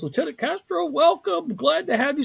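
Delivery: new branch officer warmly welcoming Lieutenant Castro; cheerful welcome. Retold or imitated. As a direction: greet him like a thrilled new boss. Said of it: "from this anger to this".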